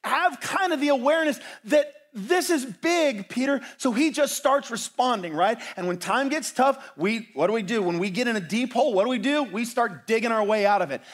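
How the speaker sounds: high, loud sermon at full force; impassioned preaching. Real, not acted.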